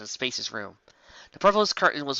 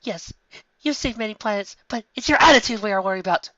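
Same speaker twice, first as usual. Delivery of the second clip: scolding mother; 9 semitones higher, 5 dB louder than usual. A narrator's reading.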